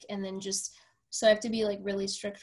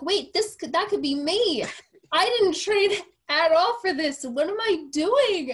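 high, loud, frantic replay of her own mid-race panic; retold panic; 8 semitones higher, 6 dB louder than usual. Runner recalling mile seventeen to the host, loud mock panic. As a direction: relive the moment you realise it could be you.

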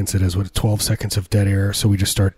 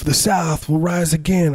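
high-pitched mock rallying cry; mock Confederate die-hard; 8 semitones higher than usual. Mocking people who want the South back, high mock zealot. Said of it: "these people who are like".

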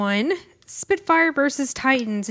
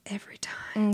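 whispering; 4 semitones lower, 8 dB quieter than usual. Real, not acted. Teasing aside to co-host.

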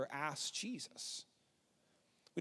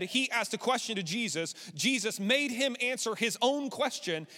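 ordinary delivery

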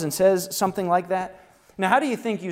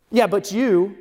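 louder, higher "yeah, but you"; louder, higher mock comeback; mock defensive retort; 5 semitones higher, 9 dB louder, slower than usual. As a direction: imitate someone snapping back defensively. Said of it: "they start doing the whole "but you".

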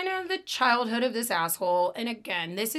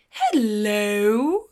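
Mrs. Doubtfire voice; 7 dB louder than usual. Shown as a demonstration. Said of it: "when you call me Missus Doubtfire".

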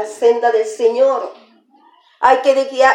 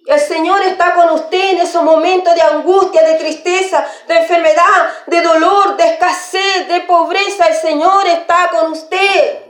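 higher-pitched, driving preacher's voice; fervent preaching; 6 semitones higher than usual. Acted. Preacher assuring the afflicted that God is with them, preaching fervently. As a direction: proclaim it with insistent, mounting fervor.

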